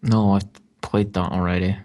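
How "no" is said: ordinary talk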